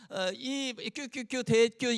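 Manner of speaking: 뀨 drawn out very slowly; mocking his own cutesy greeting; 느리게 늘인 장난스러운 뀨. Shown as a demonstration. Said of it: "얼마나 채통머리가 없어 보이냐고요".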